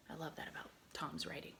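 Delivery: fast soft whisper; soft quick whisper about the author; whispered aside. Real, not acted.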